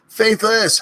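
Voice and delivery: high piercing yell; screamed name. Acted. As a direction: scream it at the top of your lungs. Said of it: "I end up screaming too much".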